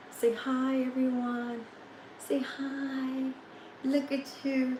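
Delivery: high, soft coaxing to the dog. Real, not acted. Owner asking tired dog to greet viewers, soft high coo. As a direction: coo quietly at the pet.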